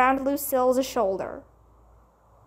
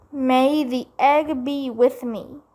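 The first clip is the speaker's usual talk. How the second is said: solemn awe. Acted. Sheldon solemn after swallowing hard.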